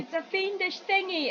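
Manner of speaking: high, slow, drawn-out mock alarm; playful high-pitched film impression; imitated film line. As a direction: savour the quote in a put-on high voice. Echoing the film quote in character.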